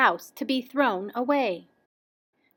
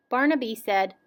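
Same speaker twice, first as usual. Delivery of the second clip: ordinary delivery, nothing marked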